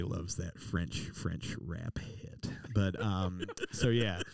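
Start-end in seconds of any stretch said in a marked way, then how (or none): 0.0-2.5 soft, slow whispered punchline; deadpan whisper; whispered aside. Real, not acted. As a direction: breathe it out as a secret joke.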